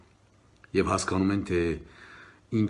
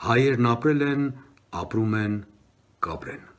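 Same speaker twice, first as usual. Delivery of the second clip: declaimed verse, high and loud openings falling to measured refrains; poem recitation; 5 semitones higher, 6 dB louder, much faster than usual. Acted. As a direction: recite a defiant patriotic poem, forceful then sinking.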